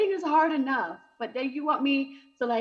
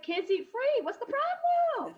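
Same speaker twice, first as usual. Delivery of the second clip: sassy retort; 13 semitones higher than usual. Retold or imitated. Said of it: "I'm like".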